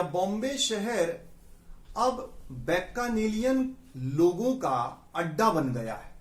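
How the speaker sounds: slow example sentence. Shown as a demonstration. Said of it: "ऐसे प्रयोग होगा".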